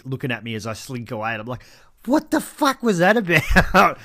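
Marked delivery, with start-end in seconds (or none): 2.1-4.0 slow, higher baffled question; bewildered. Retold or imitated. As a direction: ask it in slow disbelief. Bewildered retold reaction.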